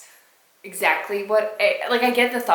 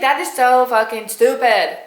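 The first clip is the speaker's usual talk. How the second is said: louder, fed-up outburst at a stupid line; a frustrated reader's loud rant; exasperated outburst; 3 semitones higher, 8 dB louder than usual. Retold or imitated. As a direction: vent it like you can't stand one more word. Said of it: "And I'm just like".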